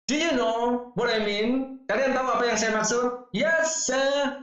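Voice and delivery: acted classroom dialogue. Acting teacher-student dialogue.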